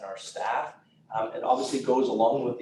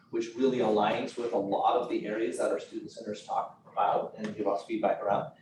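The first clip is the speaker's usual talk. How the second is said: ordinary delivery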